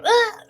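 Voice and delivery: short high yelp like a Wilhelm scream; quick falling movie scream. Acted. Enemy yanked away, gives a Wilhelm scream. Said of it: "a quick Wilhelm scream".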